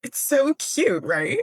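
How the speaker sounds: put-on high, drawn-out girly gush; imitated gushing. Girl forgetting her attack to admire a skirt, mock gushing. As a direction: gush over it slowly, imitating a delighted girl. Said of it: "completely forgets that she was attacking".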